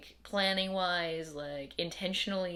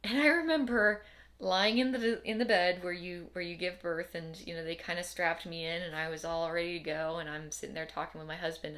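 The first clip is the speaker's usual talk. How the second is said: usual speaking voice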